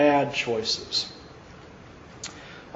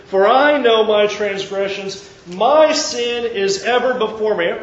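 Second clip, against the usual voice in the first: fervent, raised psalm reading; preaching a psalm; 4 semitones higher, 4 dB louder than usual. Acted. Preacher reading David's psalm to the church, fervent. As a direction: pour out the plea to God.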